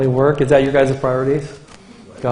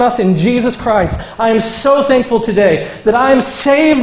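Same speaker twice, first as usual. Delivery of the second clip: preaching; 7 semitones higher, 3 dB louder than usual. Acted. Pastor to the congregation, fervent preaching. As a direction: build it up with thanks and conviction.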